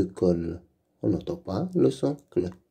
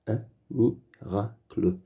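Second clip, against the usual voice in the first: Un miracle split into un, mi, ra, cle; syllable by syllable; 3 dB quieter than usual. Shown as a demonstration. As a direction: sound out "Un miracle" with gaps between syllables. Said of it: "Lis les mots".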